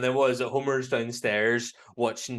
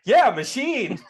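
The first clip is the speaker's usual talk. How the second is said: high, loud mock-scornful shout; Homer Simpson; 13 semitones higher, 10 dB louder, slower than usual. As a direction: jeer it like a hammed-up cartoon husband. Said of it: "Homer then comes back in".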